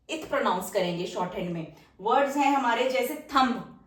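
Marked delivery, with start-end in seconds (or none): none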